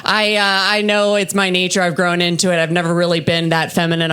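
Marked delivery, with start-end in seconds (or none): none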